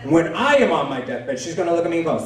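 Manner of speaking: ordinary talk, nothing marked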